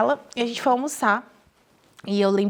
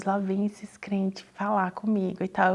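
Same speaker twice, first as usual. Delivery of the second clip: slow scornful whisper; whispered aside; 3 semitones lower, 6 dB quieter, slower than usual. Retold or imitated. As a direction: mutter it under breath, mocking the believers. Repeats the cynical aside.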